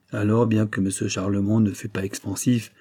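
usual speaking voice

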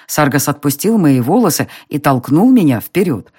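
ordinary delivery

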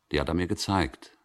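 ordinary talk, nothing marked

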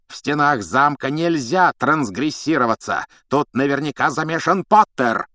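loud furious accusation; furious shout. Acted. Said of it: "надрывался Снег". (яростный крик)

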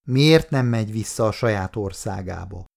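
ordinary delivery, nothing marked

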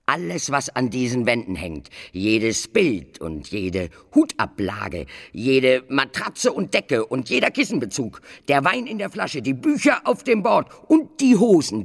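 rising furious enumeration; building up to outrage. Acted. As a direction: climb into an angry list.

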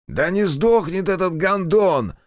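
high, slow, furious desperate yell; desperate angry yell. A narrator's reading. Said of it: "завопил кто-то в злом отчаянии".

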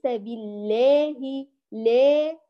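slow بالله with aa bent to "ee", "billeehi"; tilted alif. Shown as a demonstration. Teacher mimics the mistake, alif inclined toward e. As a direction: tilt the long a of لا toward e. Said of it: "لا تقولي لا".

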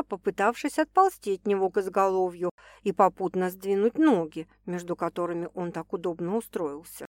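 plain reading voice

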